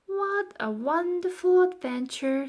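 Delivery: put-on high eager girl voice; Florentine's voice. A narrator's reading. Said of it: "said Florentine".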